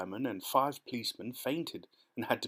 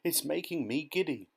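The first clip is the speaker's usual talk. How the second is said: higher-pitched, giddy complaint; plaintive call; 5 semitones higher than usual. A narrator's reading. Miss Spider giddy on the peach, plaintive calling. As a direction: wail it mildly from a height. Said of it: "Miss Spider called out".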